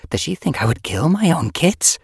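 high-pitched, outraged question; indignant cry. A narrator's reading. Yellowfang shocked at Sage Whisker's doubt, crying out. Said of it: "Yellowfang cried".

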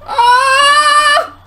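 short, high, loud scream; frightened scream. Real, not acted. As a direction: cry out in fright. Scared by the game.

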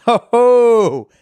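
high, loud, gleeful ho ho; delighted exclamation. Acted. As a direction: crow with delight at the news. Friend's delighted reaction acted out.

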